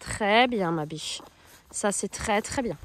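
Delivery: éloge doux et posé, plus bas; douce félicitation. Real, not acted. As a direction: rassurer la jument tout bas. (la cavalière félicite sa jument, tout doucement)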